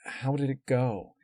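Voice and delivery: plain reading voice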